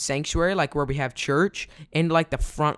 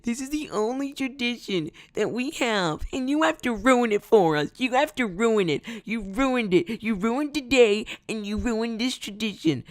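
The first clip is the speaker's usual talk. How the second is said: high-pitched mock crying, whiny and pleading; imitating wailing sobs; 8 semitones higher than usual. Pastor's wife wailing at the boy who ate communion, high mock sobbing. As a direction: ham up a grown woman bawling over her ruined tradition. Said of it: "She was crying that she was like".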